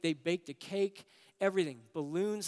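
usual speaking voice

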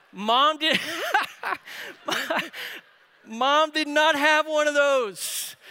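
loud, high, breaking into laughter; amused outburst. Real, not acted. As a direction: crack up, then push the words out loudly. Cracking up at his own story.

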